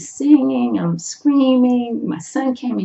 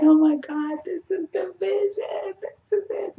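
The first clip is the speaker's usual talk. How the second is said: high, breathless and hushed with disbelief; overwhelmed awe; 11 semitones higher than usual. Retold or imitated. Fan at the stage rail, hushed, high-pitched awe. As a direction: gasp it out like a dream is coming true. At the railing, seeing her vision.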